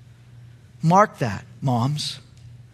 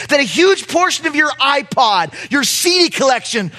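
preaching at full force; 10 semitones higher, 4 dB louder than usual. Real, not acted. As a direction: preach it out to the congregation.

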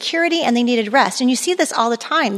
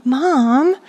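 put-on slow, drawn-out whiny teen; mock teenage whine; whining teenager; 3 semitones higher, slower than usual. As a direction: whine like a kid who thinks mom doesn't care. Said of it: "they're whining and they're annoying".

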